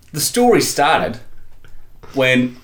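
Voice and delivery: ordinary talk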